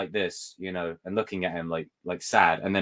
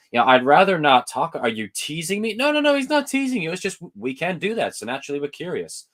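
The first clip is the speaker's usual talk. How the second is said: acted movie scene; 7 semitones higher, 5 dB louder than usual. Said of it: "It's like".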